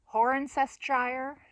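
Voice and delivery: slow, high "Horncestshire" ending in shire; overdone, loud "Horncestshire"; deliberate mispronunciation. Shown as a demonstration. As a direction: stretch "Horncestshire" out, rhyming the end with fire. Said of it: "you could say".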